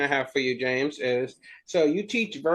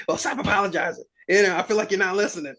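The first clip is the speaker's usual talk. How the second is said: high, quick annoyed customer comeback; mock-irritated pushback that breaks into a laugh; pestered complaint; 6 semitones higher, faster than usual. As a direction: play the fed-up customer, pitched up.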